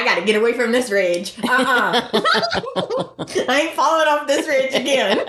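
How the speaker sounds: comic runaway voice breaking into laughter; rising, giggly put-on protest; laughing mock refusal. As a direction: crack up while imitating her refusal. Jokey voice for fleeing woman, laughing.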